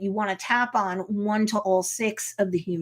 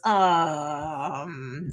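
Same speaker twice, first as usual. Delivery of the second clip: long, low, drawn-out "um"; skeptical aside; 6 semitones lower than usual. Retold or imitated. Jen breaking the fourth wall, skeptical. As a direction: imitate a doubtful hesitation. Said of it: "Jen does a fourth wall break".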